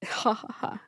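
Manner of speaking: soft, voiceless "ha ha ha"; quiet laugh at your own joke; breathy chuckle. Real, not acted. Pharmacist joking about putting down candy, soft chuckle.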